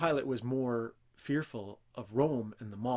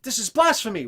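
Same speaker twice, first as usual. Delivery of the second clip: higher, louder scandalized cry; sputtering outrage; 7 semitones higher, 9 dB louder than usual. Retold or imitated. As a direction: spit it out like an offended Pharisee.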